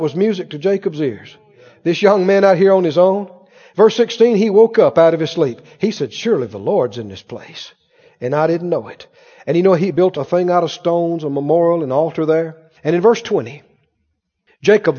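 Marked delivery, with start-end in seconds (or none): 6.1-9.1 hushed awe. Acted. Jacob waking in awe.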